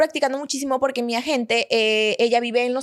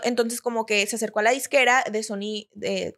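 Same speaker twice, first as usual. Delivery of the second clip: usual speaking voice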